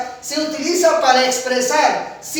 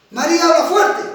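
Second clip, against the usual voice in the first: much higher and louder, strong voice; speaking loudly; 7 semitones higher, 8 dB louder than usual. Shown as a demonstration. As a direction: say it loud and strong. (fuerte y en voz alta)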